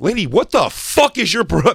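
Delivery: high, loud reenacted outburst; indignant retort. Fan confronting the security lady, retold indignant outburst. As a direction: snap back in retold outrage.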